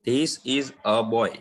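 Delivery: very slow, each word apart; very slow demo sentence. Shown as a demonstration. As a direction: dictate it slowly to the class. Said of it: "मैं बोलूंगा". (बहुत धीमे, हर शब्द अलग)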